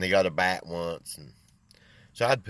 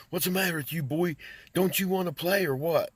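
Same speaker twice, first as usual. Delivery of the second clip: retold chewing-out; 10 semitones higher than usual. Coach to daydreaming boy in dugout: high mock scolding. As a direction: scold the boy like a fed-up coach, a bit hammed. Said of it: "I'm chewing his butt".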